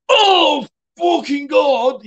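high, slow horrified shout; disgusted shout. Retold or imitated. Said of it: "lads in a bar".

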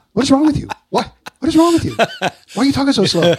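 high, loud, disbelieving yell; incredulous parents. Retold or imitated. Parents on the phone yelling at their sober son, incredulous. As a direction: shout it like a baffled parent on the phone. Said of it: "And they're like".

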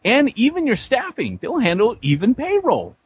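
high, swooping infomercial voice; hammed-up ad pitch. Acted sponsor pitch.

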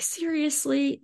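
high, soft incredulous seriously; a hushed, high "really?" at a bill; mock disbelief. Guest reacting to a medical bill, high and soft. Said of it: "I'm like".

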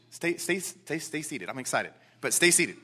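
low, very soft self-restraint with a laugh; quiet aside. Real, not acted. Preacher excited but telling himself to stay seated, quiet aside. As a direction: mutter it to yourself, amused.

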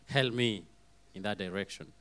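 usual speaking voice

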